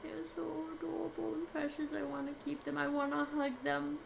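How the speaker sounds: breathy, soft, high and fast; gushing squeal. Real, not acted. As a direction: coo over something adorable. Gushing over cute NPCs.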